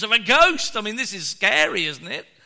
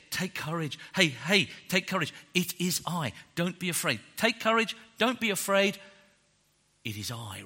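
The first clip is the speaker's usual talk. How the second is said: softer, gentle repeated comfort; tender reassurance; 4 dB quieter than usual. Retold or imitated. Jesus soothing disciples in the storm, soft and tender. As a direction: hush a scared child. Preacher voices Jesus softly.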